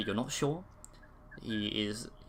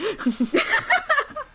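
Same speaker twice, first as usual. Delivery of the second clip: high squealing laugh; 4 dB louder than usual. Real, not acted. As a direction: giggle breaking into a squeal. Laugh after the accent bit.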